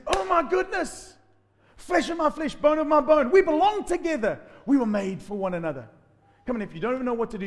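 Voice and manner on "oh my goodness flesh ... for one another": much higher and louder, thrilled and overjoyed; ecstatic Adam. Acted. Adam overjoyed at meeting Eve, loud high delight. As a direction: cry out in delight at first sight of Eve. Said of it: "he said".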